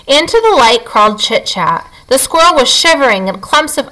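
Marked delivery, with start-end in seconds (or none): none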